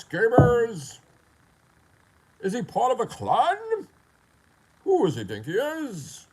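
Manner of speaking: slow, drawn-out questions with high, loud peaks; hammed-up affronted rant; mock indignation. Critics asking who he thinks he is, mock indignation.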